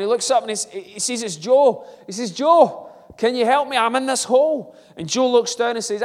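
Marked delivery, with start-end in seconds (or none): 2.3-4.7 much higher and louder shout; trapped man shouts up to his friend Joe, pleading; calling out. Acted. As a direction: shout up to your friend from the hole.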